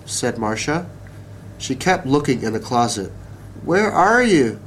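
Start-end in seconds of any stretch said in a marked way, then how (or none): 3.7-4.6 raised-pitch searching call. A narrator's reading. Girl still looking in the closet, calling out. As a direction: keep calling for it, high but not yelled. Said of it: "she called".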